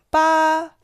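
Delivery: Ba held high and level; first tone. Shown as a demonstration. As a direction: keep Ba flat and high. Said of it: "Number eight is first tone".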